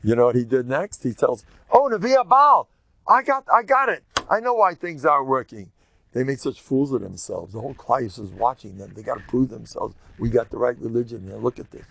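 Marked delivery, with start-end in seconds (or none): 1.7-5.7 high, loud sarcastic jeer; mocking taunt. Eliyahu taunting the prophets of Baal, loud mockery. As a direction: needle them with fake concern.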